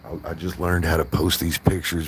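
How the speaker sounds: ordinary talk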